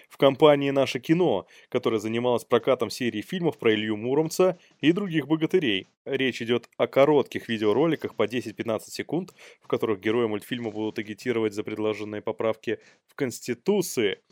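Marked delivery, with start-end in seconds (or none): none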